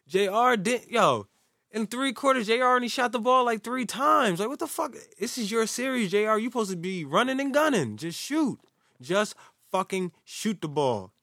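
high, worked-up rant; excited rant. Real, not acted. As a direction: rant in frustration. Ranting about J R.

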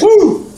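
sharp high excited woo; high woo cry. Retold or imitated. Celebrating his vision at the club, a high woo. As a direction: let out a joyous yelp. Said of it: "And I went".

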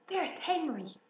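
high, excited call; cried out. A narrator's reading. Fern pointing out Henry to her mother, excited cry. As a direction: point someone out eagerly, pitched high. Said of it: "cried Fern".

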